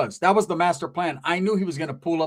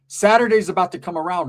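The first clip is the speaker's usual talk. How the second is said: ordinary talk, nothing marked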